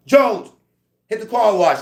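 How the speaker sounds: high, loud command; barked order. Retold or imitated. The cop ordering Jones to the car wash, high loud command. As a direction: call the inmate to his post.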